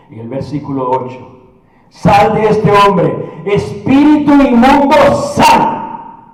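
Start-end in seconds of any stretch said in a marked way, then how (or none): 1.9-6.3 slow, forceful order rising to a final burst; drawn-out preacher's command; commanding exorcism. Acted. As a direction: cast the spirit out with authority. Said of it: "Con esa voz".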